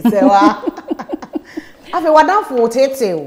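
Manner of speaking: laughing. Real, not acted. Host laughing at cheating story.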